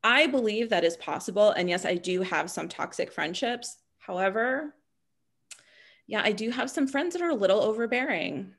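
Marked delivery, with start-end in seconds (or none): none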